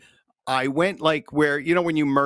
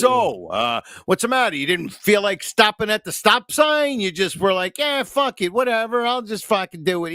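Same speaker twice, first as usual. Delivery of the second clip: high, mocking cop voice, a little louder; sarcastic cop; 8 semitones higher, 3 dB louder than usual. Traffic cop sarcastically scolding a driver who ran a stop sign, high and mocking. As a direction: sneer at him like a smug traffic cop. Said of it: "sarcastic jerk off".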